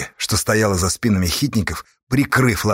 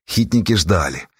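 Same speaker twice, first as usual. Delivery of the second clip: ordinary delivery, nothing marked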